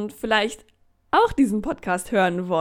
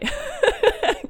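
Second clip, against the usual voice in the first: high laugh. Real, not acted. Joking about listener numbers.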